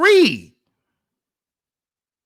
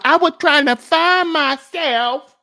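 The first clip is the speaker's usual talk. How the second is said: higher, louder mocking imitation; mocking whine; 5 semitones higher, 5 dB louder than usual. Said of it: "Talking about".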